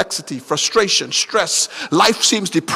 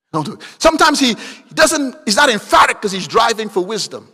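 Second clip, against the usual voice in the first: normal talking voice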